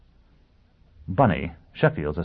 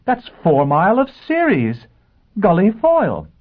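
high, loud, stretched-out announcement; astonished reveal; 11 semitones higher, 6 dB louder than usual. A narrator's reading. Assistant revealing the visitor to his chief, astonished. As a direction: cry out the name in amazement.

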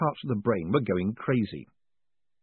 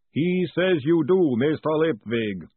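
slow, steady golem; measured, unhurried and dutiful; 5 semitones higher, 5 dB louder, slower than usual. A narrator's reading. Golem wakes Moist, slow and even.

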